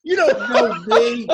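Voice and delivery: slow mock snarl at a well-wisher; mock snapping at a fan. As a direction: brush off the fan in mock contempt. Mock rude reply to fan.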